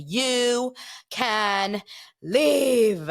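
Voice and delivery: imitating an overwrought soap-opera showdown; soap-opera melodrama. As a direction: draw each word out like a soap diva. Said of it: "it was Days of Our Lives".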